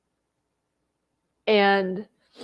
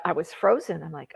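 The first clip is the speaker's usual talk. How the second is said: ordinary delivery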